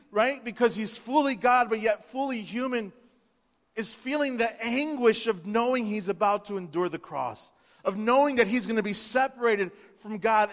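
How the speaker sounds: ordinary delivery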